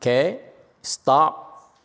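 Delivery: usual speaking voice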